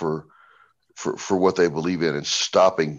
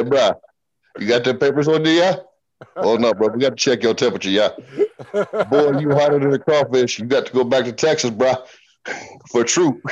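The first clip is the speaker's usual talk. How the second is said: put-on jokey Cajun accent, pitched up, playful; 5 semitones higher than usual. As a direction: checkpoint guard teasing in a hammed-up Cajun accent. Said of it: "in full up coon ass accent".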